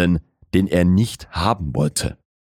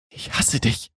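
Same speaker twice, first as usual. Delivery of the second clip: hushed, high female whisper; quiet; 7 semitones higher, 4 dB quieter than usual. A narrator's reading. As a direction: breathe the hatred out in a higher-pitched whisper. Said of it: "sagte Jess leise". (leise geflüstert, mit höherer Frauenstimme)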